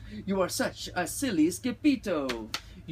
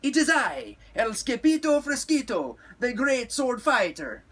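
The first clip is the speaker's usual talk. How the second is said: loud, high heroic proclamation; called out; 9 semitones higher, 6 dB louder than usual. A narrator's reading. Skipito introduces himself to unseen amigos, calling loudly. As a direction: announce yourself like a swashbuckler, pitch up. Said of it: "called out Skippy John Jones".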